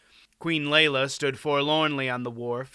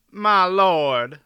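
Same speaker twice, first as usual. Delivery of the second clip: louder, higher character voice; pained, raised-voice appeal; pained objection; 5 semitones higher, 10 dB louder than usual. A narrator's reading. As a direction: protest loudly, as if pained. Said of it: "Silk said with a pained look".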